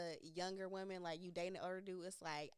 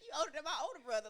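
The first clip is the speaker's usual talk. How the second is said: fast laughing quote; 6 semitones higher, 5 dB louder, much faster than usual. Retold or imitated.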